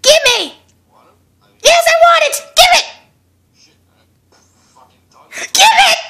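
loud, high demands building to shrieks; greedy shouting. Pretend. Viewer demanding the fortune cookies on screen, greedy shouting.

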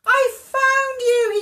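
loud, high, drawn-out cry of joy; joyful cry. A narrator's reading. Sock greets his long-lost pair, joyful cry. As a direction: call it out long and elated. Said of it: "he cried".

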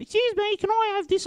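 squeaky falsetto impression of a woman; mock high lady voice. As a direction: go way up high and dainty for her. Dad's story, lady in undies.

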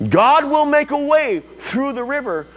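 high, slow, emphatic proclamation with hushed drops; forceful preaching. Real, not acted. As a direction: proclaim it to the congregation, stretching key words.

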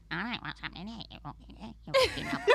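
goblin-like exaggerated accent mimicry; insulting impression. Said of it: "did a really insulting impression of me".

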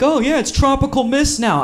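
high, airy mock voice of an excited local; lightly mocking a chirpy weather report; mock someone. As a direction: toss it off in a high put-on voice. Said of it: "someone's like".

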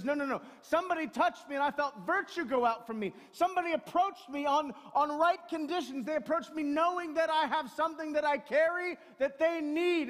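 loud, high, insistent declaration; Jesus overruling the disciples, loud and insistent. Retold or imitated. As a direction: plant your feet and insist. Jesus insisting someone touched him.

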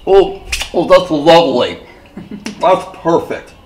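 ordinary talk, nothing marked

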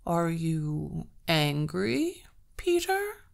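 sly troll, slow and drawling; a creeping troll taunt. Acted. Troll mockingly asks the servant.

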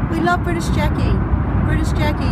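normal talking voice

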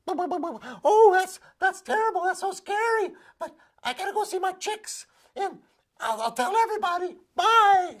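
very high, loud clucking hen voice; a fussy hen hurrying away; shrill, dismissive barnyard hen; flighty hen. Acted. Hen brushes off mouse.

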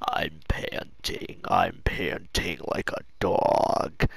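very low, slow, voiceless croak; imitated lost voice. Retelling how he sounded saying it, imitated lost voice. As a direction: croak it out softly as if the voice has gone. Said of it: "couldn't even fucking talk with my voice".

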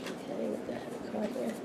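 soft unvoiced whisper. Real, not acted. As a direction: say it under your breath. Puzzled at a card.

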